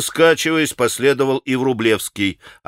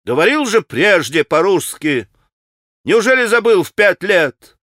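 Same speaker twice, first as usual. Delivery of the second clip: raised, higher voice of reproach; angry; 5 semitones higher, 4 dB louder than usual. A narrator's reading. Grushenka reproaches the pan for forgetting Russian, angry. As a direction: build into a louder, higher rebuke. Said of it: "Она вся покраснела от гнева".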